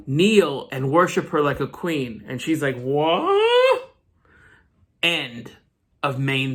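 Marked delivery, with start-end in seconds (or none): 2.8-3.9 much higher, stretched puzzled what; acting out total confusion; mock baffled what. Lorna baffled by kneeling guards.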